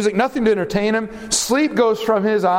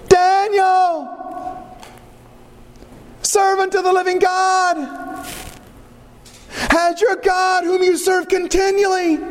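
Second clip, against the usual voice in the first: lamenting cry; 12 semitones higher than usual. Acted. King calling down into the lions' den, lamenting. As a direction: cry out to Daniel in grief and hope. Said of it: "I didn't make up that tone".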